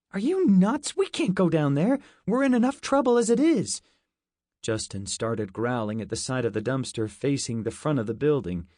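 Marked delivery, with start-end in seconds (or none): 0.1-3.8 high and loud, panicked objection; a higher, louder voice telling a friend he's crazy; alarmed protest. Acted. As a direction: shout it as a frightened kid.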